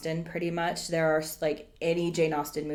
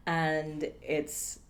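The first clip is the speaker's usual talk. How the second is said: ordinary talk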